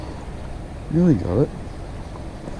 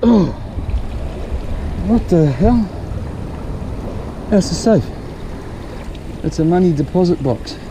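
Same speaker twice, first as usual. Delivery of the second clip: excited; 6 semitones higher than usual. Real, not acted. Finds a safe magnet fishing.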